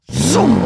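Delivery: loud whooshing zoom; a sudden vocal whoosh of a car speeding off; imitated car zoom. Mother mimics the driver speeding away, loud zoom. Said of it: "Och hon bara".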